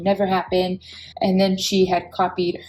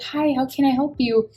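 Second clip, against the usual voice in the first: sweet receptionist; 6 semitones higher than usual. Retold or imitated. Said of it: "sweet she was".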